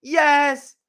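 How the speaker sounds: imitating an excited receiver celebrating; pumped-up imitation. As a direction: mimic a player jumping for joy. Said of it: "He's like".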